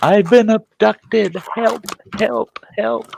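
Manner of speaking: high, drawn-out fake victim wail; hammed-up high-pitched plea; mock cry for help. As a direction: squeal like a pretend kidnapping victim. Imitates daughter's fake abduction cries.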